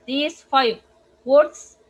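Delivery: ordinary talk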